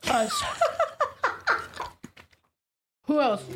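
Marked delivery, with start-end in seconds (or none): 0.5-2.1 high-pitched burst of laughter; real, high giggling fit. Real, not acted. Cracking up at co-host's banter, high laugh.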